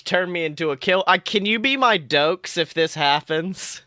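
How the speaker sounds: ordinary talk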